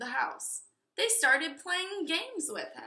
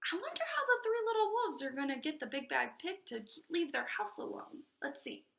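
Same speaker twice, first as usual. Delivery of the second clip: fast, breathy whisper; whispered aside; 6 dB quieter, faster than usual. Real, not acted. Reader musing aside to the listener, whispered. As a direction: lean in and whisper the wonder.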